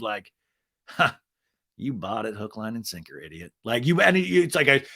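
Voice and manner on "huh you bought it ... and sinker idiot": low, soft cold killer reveal; a hint of cold menace, lower and softer; cold-blooded killer. Killer dropping his act on his lawyer, low cold sneer. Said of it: "the cold-blooded killer".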